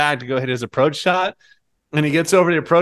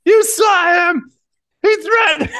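much higher, loud, slow shouted impression of the furious golfer; mock angry golfer; 5 dB louder, slower than usual. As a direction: ham up the golfer's outrage in a shrill shout.

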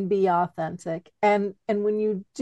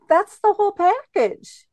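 excited; 12 semitones higher, 7 dB louder than usual. Real, not acted. Emphatic point in podcast chat.

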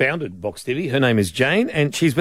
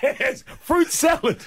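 laughing; slower than usual. Real, not acted. Laughing reaction.

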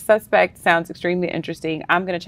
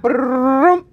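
rolled "brrrr" drum roll, pitched up; imitated drum roll; 5 semitones higher than usual. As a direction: trill a drum roll before the reveal. Drum roll before announcing number one.

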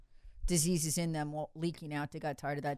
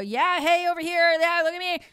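high, loud mocking shout for attention; mock yelling; 10 semitones higher, 6 dB louder than usual. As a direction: ham up a guy yelling look at me. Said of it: "he is out there screaming and yelling that".

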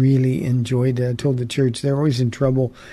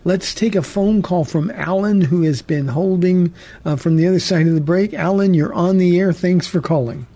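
bright on-air host voice, higher; radio host; 4 semitones higher than usual. Acted. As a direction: welcome listeners like a radio host. Host opens the call segment.

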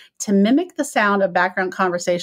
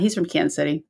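usual speaking voice

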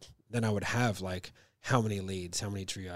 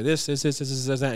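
staccato; 5 semitones higher, 5 dB louder than usual. Shown as a demonstration. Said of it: "I'm very staccato with the voice".

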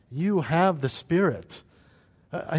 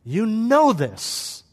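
acted forceful rebuke, slowing for emphasis; forceful exasperation; 3 semitones higher, much slower than usual. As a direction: scold them as if they should know better. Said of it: "could be so forceful and say".

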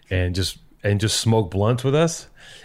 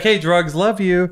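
higher mock love note to weed; mock love letter; 8 semitones higher, 4 dB louder, slower than usual. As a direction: sweet-talk the drugs, lightly hammed up. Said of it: "I'd be like".

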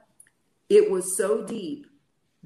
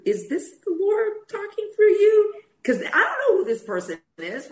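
higher and louder, laughing through tears; laughing disbelief; 14 semitones higher, 6 dB louder than usual. Retold or imitated. To the stranger at the airport, laughing through tears. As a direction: break into a tearful laugh at the wonder of it.

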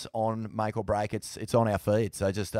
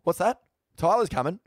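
higher, louder mock surprise on the phone; mock phone call; 7 semitones higher, 5 dB louder than usual. Manager taking a call about Tyler, mock surprised. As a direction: play the manager hearing news on the phone. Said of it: "gets the phone call".

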